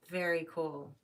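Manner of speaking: normal talking voice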